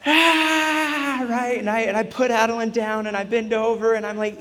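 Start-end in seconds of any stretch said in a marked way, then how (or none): 0.1-1.3 high piercing mock scream of a toddler; retold child's wail, pitched way up; imitated child scream. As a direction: shriek it like a hurt little girl. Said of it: "she just screams".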